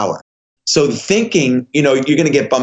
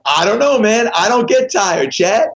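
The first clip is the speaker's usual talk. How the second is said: giddy joking; 8 semitones higher than usual. Retold or imitated. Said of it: "We were laughing".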